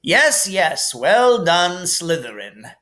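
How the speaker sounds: polite, dismissive headmaster; Dumbledore voice. Acted. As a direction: brush off the applause gently. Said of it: "said Dumbledore".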